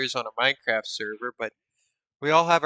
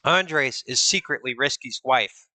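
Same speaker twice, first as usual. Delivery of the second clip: ordinary delivery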